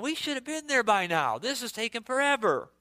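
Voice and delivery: mock child complaining on a car trip; whiny child.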